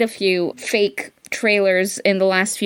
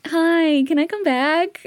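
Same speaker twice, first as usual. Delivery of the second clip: sheepish sweet voice; 8 semitones higher than usual. Retold or imitated. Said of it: "so now she's like".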